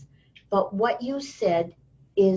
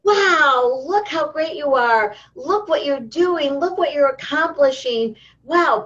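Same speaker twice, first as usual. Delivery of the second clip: higher, enthusiastic parental praise; gushing praise; 4 semitones higher than usual. Acted. Parent praising child doing fabulous things, gushing. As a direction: gush proudly over a child's achievement. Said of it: "give them attention when they're doing fabulous".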